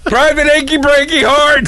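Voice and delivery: laughing mock drill sergeant. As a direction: laugh through it. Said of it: "drill instructor quote continued".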